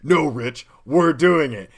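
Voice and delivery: loud, emphatic retold refusal to back down; mock firm insistence. Retells own firm insistence.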